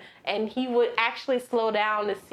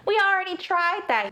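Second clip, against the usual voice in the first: high, pleading wife; squeaky mocking wife; 9 semitones higher, 3 dB louder than usual. Wife scolding the husband, high mock voice.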